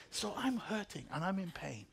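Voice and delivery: ordinary talk, nothing marked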